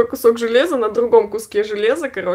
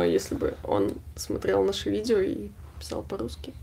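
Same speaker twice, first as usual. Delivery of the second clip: whisper; 9 dB quieter than usual. Real, not acted. Joking aside about Elon Musk.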